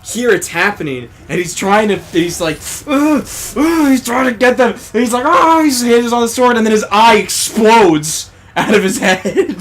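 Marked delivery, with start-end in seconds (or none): 2.9-3.9 higher, tight effortful grunts; higher mock grunts of effort; straining grunts. Yujiro straining against restraint. 5.2-5.6 much higher, louder cry of strain; much higher, louder battle cry; effortful cry. Retold or imitated. Yujiro breaking free.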